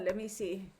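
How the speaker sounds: ordinary talk, nothing marked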